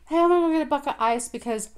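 high, quick, loud casual chirp; chirpy cover story; breezy fake excuse. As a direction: act casual to slip out. Friend fakes excuse to leave.

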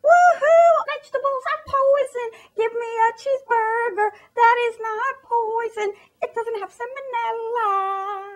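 hammed-up joy, half sung at the end; giddy cheering. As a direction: cheer like junk food just won. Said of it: "Americans are all like".

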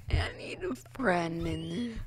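whisper. Real, not acted. Scared alone in game.

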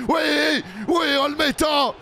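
exultant cheer; triumphant shout. Real, not acted. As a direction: roar as the keeper saves. Decisive save.

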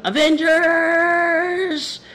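high, long drawn-out rallying call; rallying battle cry. Retold or imitated. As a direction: stretch it out like a hero summoning his team. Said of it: "sabi niya, ano".

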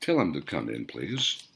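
calm boss whispering into the intercom; controlled whisper. A narrator's reading. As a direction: keep it quiet and in control. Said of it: "Hans spoke in a controlled whisper".

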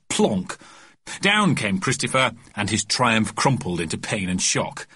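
ordinary delivery

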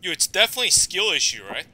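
fast, loud mocking jab; loud sarcastic quip; loud sarcasm. Real, not acted. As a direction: throw it out sarcastically. Sarcastic self-mockery.

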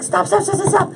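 high, rapid repeated plea; mock urgent halt. Camera guy halting the cat attack, mock urgency. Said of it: "the camera guy's like".

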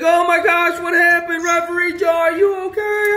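high, fretful crowd gasping; worried crowd voice. Acted. Crowd in the toy race calling to the referee, worried and high.

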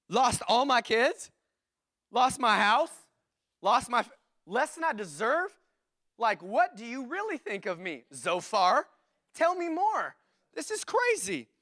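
rising, high disbelieving questions, slow at first; incredulous hurt. Pretend. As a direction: ask it like you can't believe the insult. Job's bitter disbelief, pastor voicing.

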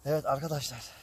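tired, breathless lying down; winded and worn out; gasping for air. Real, not acted. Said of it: "Yoruldum ya, vallahi yoruldum".

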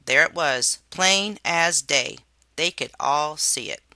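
plain reading voice

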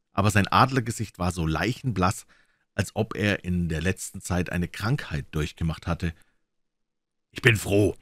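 usual speaking voice

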